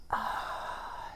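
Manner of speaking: slow breathy exhale through the mouth; long soft sigh. Real, not acted. Blowing out a long breath to relax before the session.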